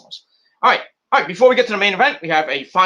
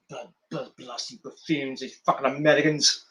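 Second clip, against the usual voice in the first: muttering Regal; 3 semitones lower, 7 dB quieter than usual. Retold or imitated. Voicing Regal muttering insults.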